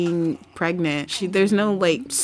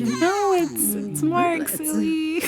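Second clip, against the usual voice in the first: Norma's reply; 7 semitones higher, slower than usual. Retold or imitated. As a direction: coyly correct her in a higher voice. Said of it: "And she's like".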